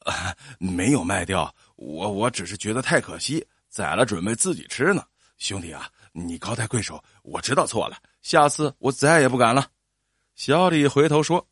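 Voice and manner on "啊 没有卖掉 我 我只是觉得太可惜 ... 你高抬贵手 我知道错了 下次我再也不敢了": low, faltering, contrite plea; pleading. A narrator's reading. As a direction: beg humbly for mercy. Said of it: "央求道". (低声下气地央求)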